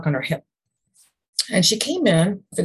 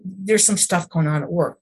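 ordinary talk, nothing marked

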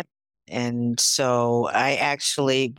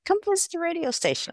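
very high, quick mock invitation; acted coaxing invitation; faster than usual. Student recruiter repeating his invitation, high and quick imitated coaxing.

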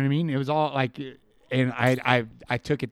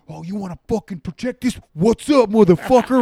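higher, louder challenging yell; loud drunken street-fight taunt; 7 semitones higher, 10 dB louder than usual. Retold or imitated. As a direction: bait him into fighting, loud.

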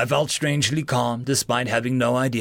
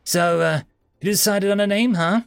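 cheerful; 8 semitones higher, 4 dB louder than usual. A narrator's reading. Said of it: "she said cheerfully".

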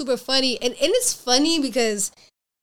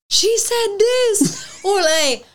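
higher, needling voice egging on a fight; mocking instigator voice; 9 semitones higher than usual. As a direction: stir up trouble in a teasing tone. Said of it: "he was just like".

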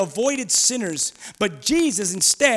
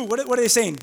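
usual speaking voice

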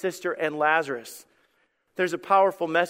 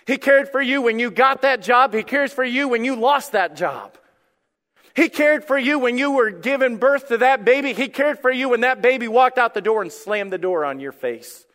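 higher, louder, rhythmic refrain; impassioned preaching; 6 semitones higher, 5 dB louder than usual. Real, not acted. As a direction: drive each promise home to the congregation. Preaching at full force.

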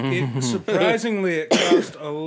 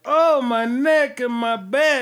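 high, loud, drawn-out mock wail; hammed-up cry of pain; feigned injury; 9 semitones higher, 6 dB louder, slower than usual. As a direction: fake a whiplash victim's agony. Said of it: "I'd have been like".

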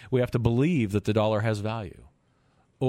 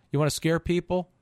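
usual speaking voice

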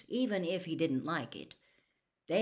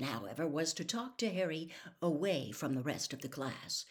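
usual speaking voice

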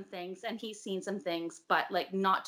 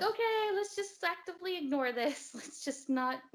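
higher-pitched, airy brush-off; mock denial; 9 semitones higher than usual. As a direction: play at pretending all is fine. Acting out couple's denial.

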